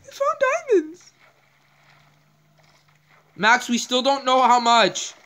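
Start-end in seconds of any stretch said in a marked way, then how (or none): 0.1-1.1 high, cracking yell of joy; excited shriek. Real, not acted. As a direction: still squealing over the find. Finding diamonds in Minecraft.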